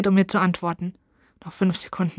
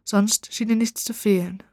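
usual speaking voice

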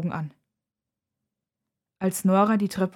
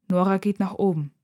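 ordinary delivery

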